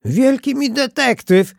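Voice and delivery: put-on high, loud child's jeer; scornful kid's outcry; mocking shout. A narrator's reading. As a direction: shout it out like a scoffing little boy. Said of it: "krzyknął Staś".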